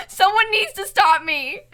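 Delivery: high, helpless whine breaking into laughter; laughing despair. Real, not acted. Streamer begging someone to stop them, high laughing despair. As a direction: plead in a high voice, half laughing. Despairs at not stopping herself.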